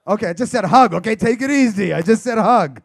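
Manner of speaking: loud, pleading protest at the crowd; loud mock defense. Real, not acted. Comedian to startled audience, loud mock protest. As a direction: wave off the crowd, almost shouting.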